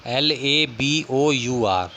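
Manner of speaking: letters spaced out slowly; teacher spelling a word slowly for students. Shown as a demonstration. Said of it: "British English में बन जाएगा".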